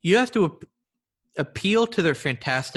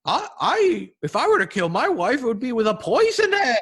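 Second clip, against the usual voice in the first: high, squeaky bunny voice pitched up; Easter bunny voice; 9 semitones higher, 4 dB louder than usual. Acted. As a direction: play a timid Easter bunny confessing. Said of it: "I like your Easter bunny voice".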